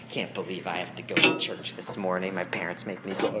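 low, sulky mumbled complaint; grumbling teen. Pretend. Preacher voicing a teen grumbling about the sunrise, low and sulky. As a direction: grumble it like a sleepy teenager.

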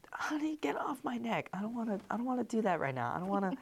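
high, hushed half-asleep murmur; drowsy wife. Acted. Wife to husband asleep in bed, hushed complaint. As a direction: softly brush someone off in a high voice.

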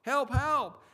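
high-pitched mock cry for help; lost hiker imitating his own scream; retold scream for help. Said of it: "I screamed again".

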